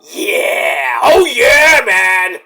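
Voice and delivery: loud celebratory shout; hype shout. Retold or imitated. Cheering like Booker T, loud shouting. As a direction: holler like a wild commentator.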